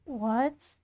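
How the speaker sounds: ordinary delivery, nothing marked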